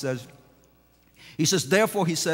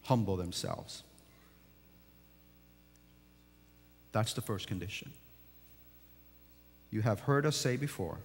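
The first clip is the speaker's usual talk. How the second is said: low, soft, unhurried preaching; hushed sermon; 10 semitones lower, 9 dB quieter than usual. Acted. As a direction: draw the congregation in quietly.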